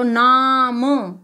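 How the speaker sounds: नाम with a very long आ before the म; long vowel sound. Shown as a demonstration. Teacher showing the long sound, नाम stretched out. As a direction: draw out the ना in नाम, slowly. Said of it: "sound देखेंगे आप".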